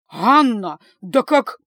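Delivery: loud, exasperated cry; indignant protest. A narrator's reading. Mother cut short by her daughter, indignant and loud.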